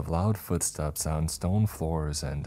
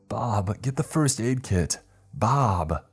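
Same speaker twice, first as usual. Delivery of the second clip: high, raised-voice emergency call; urgent call for help; 6 semitones higher, 4 dB louder, slower than usual. Acted. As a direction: call out to Bob in alarm. Calling urgently for first aid.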